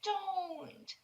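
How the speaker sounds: put-on a child breaking down in grief; despairing girl.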